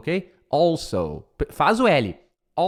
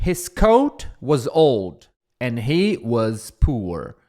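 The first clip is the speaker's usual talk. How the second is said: slow model reading; 3 semitones lower, much slower than usual. Shown as a demonstration. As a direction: read the English line slowly and clearly. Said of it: "model English sentence".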